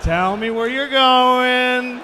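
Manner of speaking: slow, loud official voice; stern official. Retold or imitated. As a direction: drawl it out like a suspicious official. Pilot questioning him, acted.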